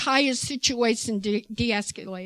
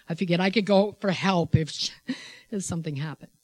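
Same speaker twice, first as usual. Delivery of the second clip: ordinary talk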